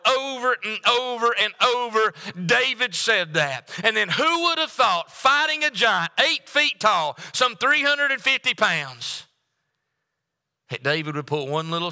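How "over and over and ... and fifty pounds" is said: higher, faster preaching build. Acted. Preacher rousing the congregation about David, fast and higher. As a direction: drive the point home to the church.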